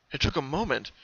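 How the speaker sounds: usual speaking voice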